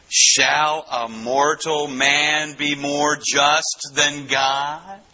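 very slow, drawn-out serpent speech; slithering serpent. Acted. Preacher voices the spirit questioning Eliphaz, slow slithering serpent. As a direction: stretch each word out like a sly snake. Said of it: "the slithering serpent".

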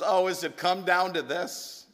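put-on sighing old woman. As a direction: let it out like a sick old woman giving up. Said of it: "She sighed and says".

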